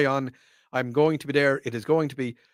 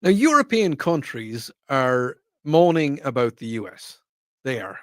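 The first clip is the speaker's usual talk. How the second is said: ordinary talk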